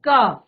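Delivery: a clear क, as in "ka"; sound demo. Shown as a demonstration. Teacher models क so the class can repeat it. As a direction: say क cleanly with its final a. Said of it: "क शब्द का उच्चारण कीजिए सभी साथ में".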